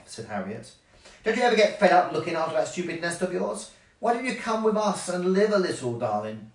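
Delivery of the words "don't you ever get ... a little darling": higher, sassy character voice; taunting insect friend in a storybook; goading horsefly. A narrator's reading.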